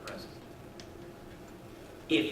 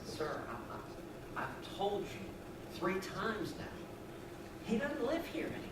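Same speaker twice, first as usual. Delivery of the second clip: tired, sighing Marine, quieter; exasperated, patience worn thin; weary sigh; 3 semitones higher, 13 dB quieter than usual. Acted. Marine to the old man, sighing and exasperated. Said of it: "the Marine kinda sighs".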